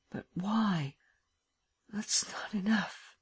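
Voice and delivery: slow whispered musing. A narrator's reading. Lupin puzzling it out alone, whispering. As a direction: whisper the clues to yourself. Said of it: "and in a lower voice".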